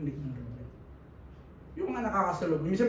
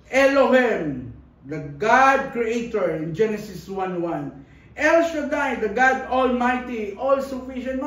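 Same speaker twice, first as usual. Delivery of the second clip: slow, emphatic names of God, stretched out; preaching proclamation; 5 dB louder, slower than usual. Acted. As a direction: proclaim each name to the congregation.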